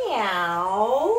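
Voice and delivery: a drawn-out playful meow; cat meow. Shown as a demonstration. Kids' yoga teacher showing the cat pose, playful meow. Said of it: "add the sound of a cat".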